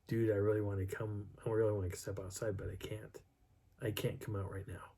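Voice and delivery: soft, low, confidential murmur; hushed, low-pitched retold plea; saying it quietly. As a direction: keep it down so a sleeper won't hear. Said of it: "I was saying quietly".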